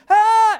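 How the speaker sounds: sharp high yelp, cut short; sudden cut-off scream. Shown as a demonstration. Teacher showing students an interrupted cry, high sharp yelp. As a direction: scream once and stop dead.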